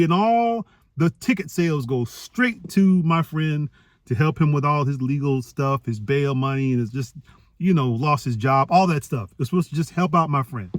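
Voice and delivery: normal talking voice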